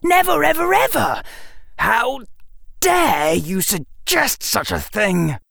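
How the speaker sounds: high-pitched indignant outburst; shouted. A narrator's reading. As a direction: protest in outrage, stressing the key words. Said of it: "Harry shouted".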